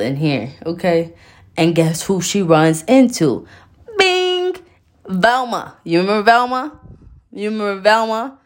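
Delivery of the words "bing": high ding of a sound effect; made-up sound effect. Pretend. Introducing Velma, a high bing effect. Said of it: "Do y'all like my made-up sounds".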